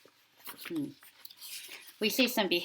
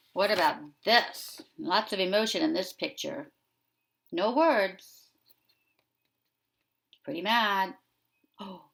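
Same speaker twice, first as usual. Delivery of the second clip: usual speaking voice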